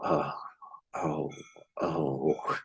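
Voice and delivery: low, soft sighed groans, imitating the reaction; mock-sighing "oh", spaced out with pauses; weary sigh, retold. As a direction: sigh out each one, sinking low and quiet. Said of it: "we'd sort of sigh".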